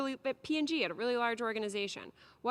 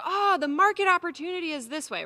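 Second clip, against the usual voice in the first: high, louder excited big-picture pitch; mock visionary; 8 semitones higher, 6 dB louder than usual. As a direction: sell the vision with a lift. Big picture thinker voiced.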